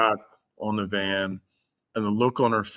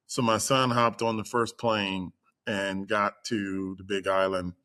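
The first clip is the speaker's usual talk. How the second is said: normal talking voice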